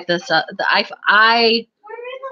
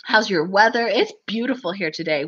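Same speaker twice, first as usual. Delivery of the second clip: ordinary delivery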